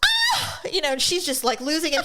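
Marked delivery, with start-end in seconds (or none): 0.1-0.6 very high shrieking ah; mock Karen shriek. Karen losing it in the parking lot, high shriek. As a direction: let out a high shriek of outrage. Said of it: "she's like".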